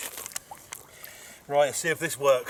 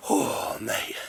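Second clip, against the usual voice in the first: hushed, breathless wonder; awed whisper. Real, not acted. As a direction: whisper it in disbelief. Marvelling at huge fish.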